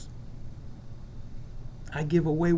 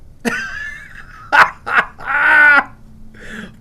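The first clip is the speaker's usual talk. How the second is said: big howling laugh; loud cackling laugh; 8 semitones higher, 10 dB louder than usual. Real, not acted. Telling the meeting about security, a howling laugh. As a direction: burst out laughing hard. Laughing retelling snowstorm story.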